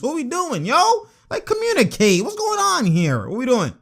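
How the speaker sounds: high-pitched, exasperated pleading. Real, not acted. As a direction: plead with your team in disbelief. Exasperated at his team on screen.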